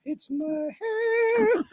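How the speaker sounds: high, slow, soft whimper; hammed-up whimper trailing off. Wail repeated, now soft.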